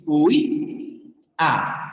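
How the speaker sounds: "Huía" split as huÍ, pause, A; stress on I. Shown as a demonstration. Teacher separating the syllables for class, stressed Í in "Huía". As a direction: stress the Í of "Huía", leave a gap before A. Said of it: "la cerrada lleva fuerza de voz".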